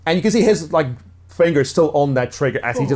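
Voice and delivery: ordinary delivery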